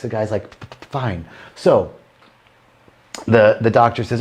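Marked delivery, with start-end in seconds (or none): 0.5-1.2 low, soft stuttered reply; stammering patient. Acted. Patient agrees to the doctor's price, quietly stammering. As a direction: catch on the P before giving in. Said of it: "with a speech impediment".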